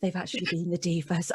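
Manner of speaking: hushed starstruck whisper. Retold or imitated. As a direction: whisper it in awe. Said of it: "I remember being like".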